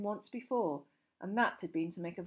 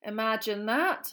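dry; 3 semitones higher, 7 dB louder, slower than usual. A narrator's reading.

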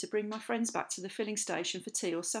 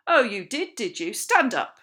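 angry command; 2 semitones higher, 11 dB louder than usual. A narrator's reading. Captain rounding on Sydney with loud, angry sarcasm. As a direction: round on him, ending in a shout.

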